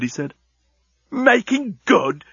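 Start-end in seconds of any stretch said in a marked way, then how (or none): 1.1-2.2 much higher, slow outraged yell. Acted. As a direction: throw it back at him in disbelief. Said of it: "yelled Leovinus".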